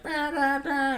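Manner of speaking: very high blaring honks; imitated car horns. Impatient drivers on busy Dundas, imitated high horn honking. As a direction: honk with the voice. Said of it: "people are already like".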